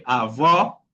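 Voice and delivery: "Avó" with an open final o, rising; outward open vowel. Shown as a demonstration. Teacher recalling the word for grandmother, open final vowel on "Avó".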